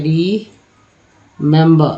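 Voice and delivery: Remember very slowly, री, then member; teacher breaking remember apart with a pause; syllable-by-syllable reading. Shown as a demonstration. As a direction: sound out remember in separate drawn-out pieces. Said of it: "लिखते, बोलते क्या पढ़ते हैं?".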